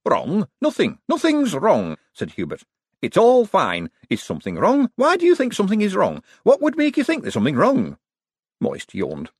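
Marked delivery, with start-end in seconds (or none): 0.1-2.0 high-pitched, jittery denial; nervy little man insisting all is well; flustered Hubert. A narrator's reading. Flustered denial that anything is wrong. 3.0-8.0 high, loud, jumpy questioning; rattled man over-insisting all is well; panicky denial. A narrator's reading. Panicky denial that anything is wrong.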